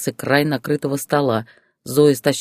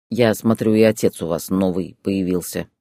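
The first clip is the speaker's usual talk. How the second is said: low, grim grumble; sullen; 6 semitones lower than usual. Acted. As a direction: drop the voice, sulky and dark. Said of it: "хмуро ответил Борис". (Борис мрачно и низко ворчит на бывшую жену)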